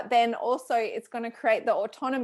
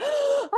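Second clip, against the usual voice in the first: mock horrified gasp; 5 dB louder than usual. Realizing she copied herself.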